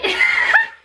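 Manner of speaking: a very high, loud shriek of laughter. Real, not acted. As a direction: burst into a squealing laugh. Bursts out laughing at the accent.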